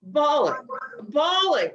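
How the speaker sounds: loud, high, insistent repeat of bawling; emphatic bawling. Real, not acted. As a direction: hammer home how hard they cried.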